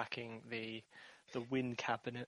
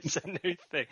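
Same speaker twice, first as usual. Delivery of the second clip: laughing; 5 semitones higher, 3 dB louder, much faster than usual. Real, not acted. Laughing echo of guest's phrase.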